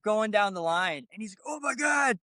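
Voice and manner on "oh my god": higher pitched mock amazement at the cashier; retold freaking out. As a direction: imitate the cashier losing it, a bit higher. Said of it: "This dude starts freaking out".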